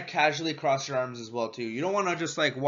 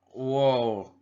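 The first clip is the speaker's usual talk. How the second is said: taken-aback whoa; 2 semitones lower than usual. Retold or imitated. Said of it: "they're gonna be like".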